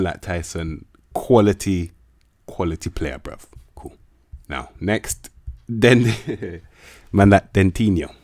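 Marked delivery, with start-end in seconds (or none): 5.7-8.1 laughing through the name, a bit louder; real giggle as he reads out the name. Real, not acted. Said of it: "I don't know why I'm laughing".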